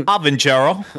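put-on American faking an Australian accent; mock Australian accent. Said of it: "Was that meant to be an English accent".

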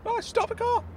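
high-pitched insistent plea; high character voice, urgent; squeaky Claude voice. Acted. Claude urging to stop.

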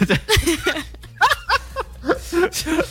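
squealing laugh; giggling fit; high giggle. Real, not acted. Joking about sex change.

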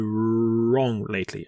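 wrong lately, dragged out slowly; slowly drawled wrong with a falling end; slow drawn-out wrong. Real, not acted. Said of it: "Is that how you wanna say it?".

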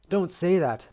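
excited cry. Acted. Mother cutting off her daughter's humble prayer, excited. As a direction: jump in to stop her words. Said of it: "my mother cried excitedly".

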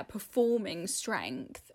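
very slow, deliberate. Real, not acted. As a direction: drag each word out. Very slow emphasis.

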